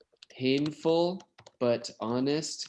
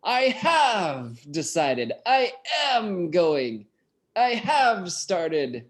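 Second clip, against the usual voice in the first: slow, firm and a little higher, every word weighted; emphatic; 4 semitones higher, slower than usual. Retold or imitated. Teacher quoting Jane's letter to the class, emphatic and slow. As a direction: read it like her mind is made up. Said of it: "Look at how emphatic".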